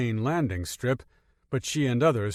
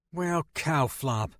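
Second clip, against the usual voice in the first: slow mild curse; 5 semitones higher, slower than usual. A narrator's reading. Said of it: "You can say the word shit".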